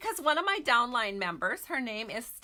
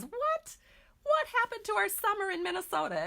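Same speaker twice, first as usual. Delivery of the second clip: much higher, fast, mock-dismayed outcry; 11 semitones higher than usual. Real, not acted. Host wearing a sweater asks viewers where summer went, mock dismay. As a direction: playfully lament the lost summer.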